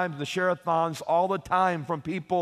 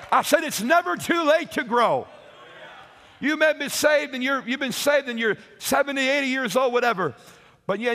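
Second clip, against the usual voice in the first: fiery preaching; 3 semitones higher than usual. Acted. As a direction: preach it hard to the congregation. Preaching climax to the congregation.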